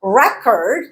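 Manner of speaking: "Record" as REC-ord, second vowel reduced to uh; first-syllable stress. Shown as a demonstration. Teacher showing the unstressed vowel, first-syllable stress. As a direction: stress the start of "Record" and weaken its end. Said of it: "is the unstressed".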